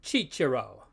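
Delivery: Chichiro with both C's as ch; soft ch sounds in Chichiro; Church Latin pronunciation. Shown as a demonstration. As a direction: say Chichiro, "chee-cheh-ro". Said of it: "and we call him".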